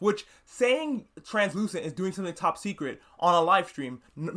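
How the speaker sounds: normal talking voice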